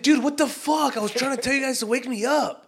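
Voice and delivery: high, loud imitation of an exasperated sleeper; mock outraged yell. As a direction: act out protesting loudly on waking. Acts stepdad waking up angry.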